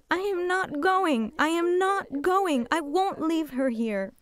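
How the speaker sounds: high, loud and slow refusal; desperate scream. Retold or imitated. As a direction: scream it, refusing to leave her mother. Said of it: "I was screaming".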